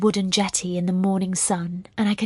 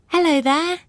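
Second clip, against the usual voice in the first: put-on high, loud English-accented hail; bright-pitched English greeting thrown downward; calling down, English accent; 9 semitones higher, 5 dB louder than usual. A narrator's reading. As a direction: call out to someone below in English tones. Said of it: "she calls down in an English accent".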